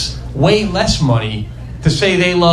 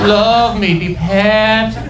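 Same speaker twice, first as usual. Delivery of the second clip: put-on slow, stretched Sofia Vergara accent; slower than usual. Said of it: "with that accent".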